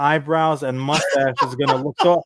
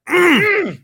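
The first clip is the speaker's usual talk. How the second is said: very high, loud appreciative hum; a swooning squeal of approval; mock swoon; 5 dB louder than usual. Charlie seeing Connery in the surf, a high loud swooning hum. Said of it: "And Charlie would be like".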